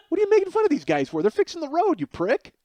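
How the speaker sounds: high, fast indignant scolding; scolding the friend for mocking workers. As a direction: squeak out the outrage at him.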